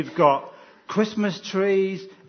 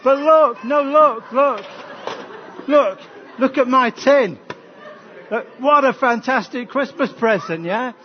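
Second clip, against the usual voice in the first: insistent mock excitement; 6 semitones higher, 6 dB louder than usual. Insisting the tin is the gift.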